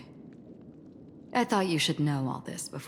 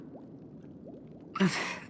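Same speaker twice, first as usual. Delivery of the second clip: soft laugh; 6 dB quieter than usual. Acted. Teasing after prank.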